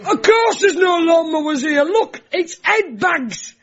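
high-pitched comic shop-worker rant; hammed-up indignant shouting; mock exasperation. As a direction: berate a clueless customer, drawn out and high. Acted rant at customer, resumed.

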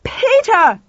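high, loud bellowed name; undignified yell. A narrator's reading. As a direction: bellow for him with all dignity gone. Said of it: "Lauren bellowed, all dignity gone".

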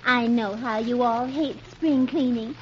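quietly explain, a hint of a comic wife; Blondie. Blondie revealing secret cleaning to husband, low soft voice. Blondie's part read by companion.

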